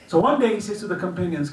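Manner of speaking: normal talking voice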